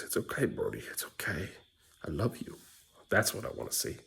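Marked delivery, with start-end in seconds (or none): 0.0-2.6 soft, tender reassurance; comforting brother. Retold or imitated. Dad showing Beckham how to comfort Brody, soft and tender. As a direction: comfort a hurt little brother softly.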